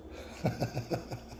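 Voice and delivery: soft breathy chuckle; laughing. Real, not acted. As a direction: chuckle quietly to yourself. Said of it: "I don't know why I'm laughing".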